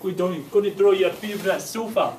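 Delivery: put-on northern accent. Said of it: "do it in a northern accent".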